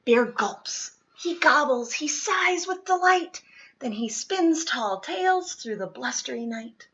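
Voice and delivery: plain reading voice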